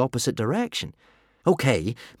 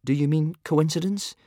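polite; 7 semitones higher than usual. A narrator's reading. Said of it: "asked Hiccup politely".

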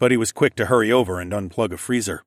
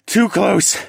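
high, louder breathless gasp; 10 semitones higher, 7 dB louder than usual. A narrator's reading. Narrator gasping after escaping the dino. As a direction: gasp it out in relief. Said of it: "I gasped".

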